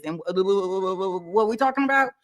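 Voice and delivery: stammering over wh- before the question; hammed-up nervous stammer; mock stutter. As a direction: act flustered, tripping on the first word. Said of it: "I will be stuttering".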